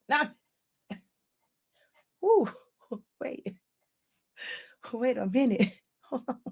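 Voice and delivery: out of breath from laughing; gasping between words, winded; breathless. Real, not acted. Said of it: "let me catch my breath".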